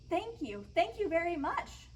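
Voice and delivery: high, delighted little-fish voice; playful bubbling thanks. Acted. Little blue fish receives a shiny scale, playful thanks. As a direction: bubble over playfully with gratitude. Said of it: "the little blue fish bubbled playfully".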